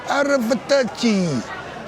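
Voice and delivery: drawn-out, high Italian farewell, slow; hammed-up Italian. Comic Brit mimics Italians saying goodbye, drawn out and high. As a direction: linger on each syllable like an opera Italian. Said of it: "full of expression".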